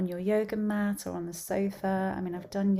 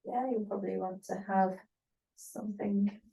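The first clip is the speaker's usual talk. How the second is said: unhurried instruction with long pauses between phrases; spaced-out relaxation teacher's voice; guided yoga nidra; 4 dB louder than usual. Acted. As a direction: guide a class gently into rest, phrase by phrase.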